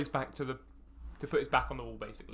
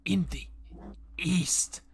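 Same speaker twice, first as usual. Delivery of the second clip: whispering; 5 semitones higher, much slower than usual. Acted. Goblin whispers location.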